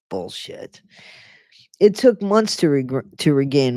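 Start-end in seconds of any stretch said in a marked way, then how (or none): 0.1-0.8 lower, muttered scoff; scoffing aside. Real, not acted. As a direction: dismiss it under your breath. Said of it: "reader scoffs at book".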